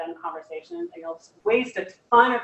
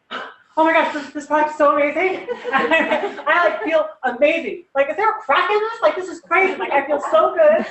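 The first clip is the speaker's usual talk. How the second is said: higher, louder, bubbling over with laughter; hyped-up teammate; 7 semitones higher, 6 dB louder than usual. Retold or imitated. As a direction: gush like someone wildly overexcited, laughing through it. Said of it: "he's talking like this all the time".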